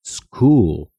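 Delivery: school said slowly as skul; slow model of school; English pronunciation. Shown as a demonstration. Teacher models the word for learners, slowly. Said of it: "se pronuncia".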